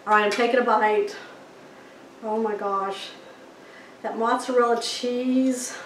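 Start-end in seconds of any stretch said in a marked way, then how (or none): none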